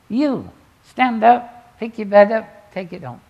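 Jesus's command. Retold or imitated. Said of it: "But Jesus says".

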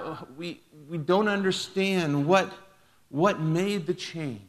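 amazed crowd, drawn out; crying out in wonder. A narrator's reading. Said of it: "The people cried out".

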